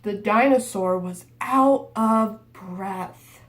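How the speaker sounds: slow, winded narration. Shown as a demonstration. Teacher reading to kids, slowing for the tired dinosaur. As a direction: drag it out like you're out of breath. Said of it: "I got really slow".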